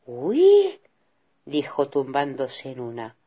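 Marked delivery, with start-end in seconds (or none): 0.0-0.8 high, loud startled little cry; a girl's sudden delighted "uy"; surprised little girl. Acted. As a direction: squeal of surprise. Girl exclaims on finding beds.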